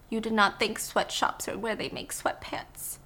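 slow, breathy horrified whisper; hushed whisper. Acted. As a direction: whisper it as if someone might overhear. Horrified hushed question to Birdie.

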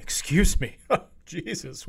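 normal talking voice

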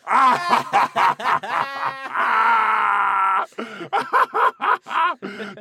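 big laugh. Real, not acted. Throws head back and laughs.

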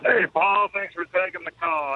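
usual speaking voice

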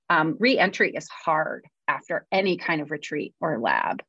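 ordinary talk

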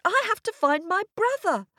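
put-on high, insistent child voice; stamping little girl. A narrator's reading. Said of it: "stamping her foot".

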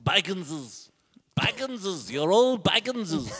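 high, put-on creature-like cry; hammed-up movie character impression; movie-quote voice. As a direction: declare it in a silly film voice. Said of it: "Just say things you heard in movies".

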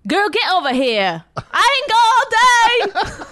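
high, loud, drawn-out scolding; hammed-up fed-up mother; single mom impression. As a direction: holler impatiently at a dawdling child. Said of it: "do a single mom voice".

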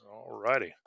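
mildly wary, quiet agreement; underwhelmed. Retold or imitated. Officer answering an excited caller on the phone, underwhelmed.

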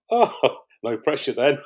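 sarcastic laughing quip. Retold or imitated. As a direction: toss off the irony through a laugh. Crew cheering, contestant joking.

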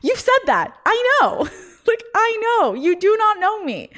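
high-pitched, laughing exasperation; exasperated. Acted. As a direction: act out being sick of hearing it. Acted exasperated reply to repeated snub.

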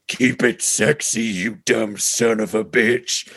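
slow, put-on monster voice; hulking beast throwing an insult; monster form. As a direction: taunt like a hulked-up monster. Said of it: "he hulks up into his monster form".

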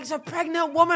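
high, outraged mock yell; indignant shout. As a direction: scold him sharply. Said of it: "He's like".

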